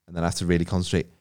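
usual speaking voice

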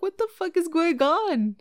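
high, loud, bewildered; reliving being totally shook; stunned by the flood of gifted subs; shocked disbelief. Retold or imitated.

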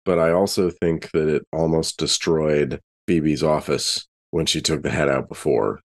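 ordinary talk, nothing marked

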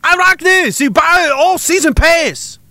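high-pitched put-on Chinese accent; mock Chinese accent. Foreign viewer raving about the Canada show, mock Chinese accent. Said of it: "Everybody's doing Chinese accents now".

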